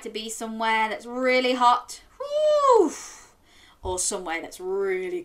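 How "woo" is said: high loud whoop; excited woo. Pretend. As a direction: cheer like it's hot. Excited about somewhere hot.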